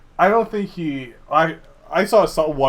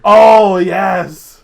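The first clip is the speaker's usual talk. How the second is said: loud, higher burst of excitement; excited recognition; 6 semitones higher, 15 dB louder than usual. Real, not acted. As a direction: cry out as it comes back to you. Suddenly recognizes the shared Dota anecdote.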